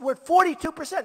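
rally-style loud quote; quoting the professor loudly. Retold or imitated. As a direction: belt out the professor's figure. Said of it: "two weeks ago, he said".

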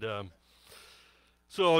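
usual speaking voice

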